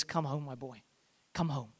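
hushed, loving father's voice; tender call. Retold or imitated. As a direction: call the lost boy home softly.

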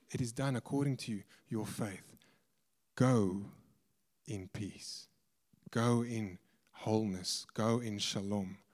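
soft, low, unhurried blessing; hushed blessing. Retold or imitated. Preacher voicing Jesus sending the woman off in peace, hushed and solemn.

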